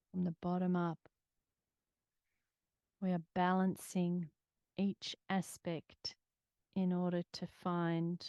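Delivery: calm steady meditation voice; guided meditation. Acted.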